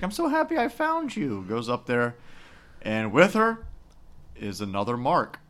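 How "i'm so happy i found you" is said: high-pitched imitation of a woman; hammed-up delighted reunion; mock gushing woman. Voicing Anna finding him.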